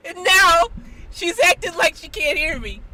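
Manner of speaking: high, loud, astonished appeal; incredulous shouting. Real, not acted. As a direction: exclaim to witnesses in disbelief. Astonished, appealing to stream witnesses.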